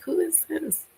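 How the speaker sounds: put-on a high, puzzled old grandma asking who's calling. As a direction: lightly play the confused grandmother on the phone. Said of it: "she was like".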